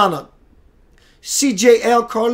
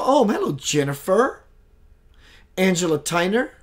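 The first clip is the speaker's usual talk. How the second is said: ordinary talk, nothing marked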